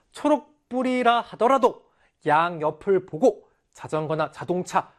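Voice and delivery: usual speaking voice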